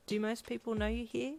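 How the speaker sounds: ordinary delivery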